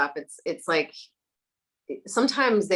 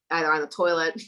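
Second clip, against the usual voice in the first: ordinary talk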